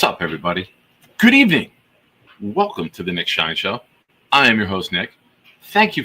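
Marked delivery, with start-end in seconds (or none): 1.2-1.7 high, loud showman greeting; booming announcer greeting. Acted. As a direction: belt it out like a show host. Host greeting, big jump.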